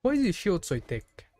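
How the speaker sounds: usual speaking voice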